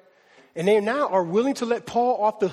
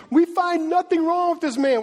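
higher, forceful protest acting out the Pharisees; sharp contention; 8 semitones higher, 4 dB louder than usual. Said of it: "contend sharply".

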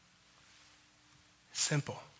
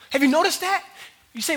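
ordinary talk, nothing marked

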